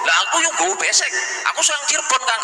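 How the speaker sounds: high, fast Javanese villager; mock villager protest. Villager protesting to an elder, high and quick. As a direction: insist you belong too.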